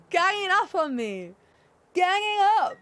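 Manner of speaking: high, loud playful protest; mock outrage. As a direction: wail in pretend indignation at being picked on. Mock protest at teasing chat.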